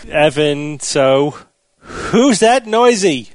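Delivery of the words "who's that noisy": slow, high drawn-out title; segment announcer. Acted.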